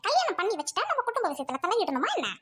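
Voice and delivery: ordinary talk, nothing marked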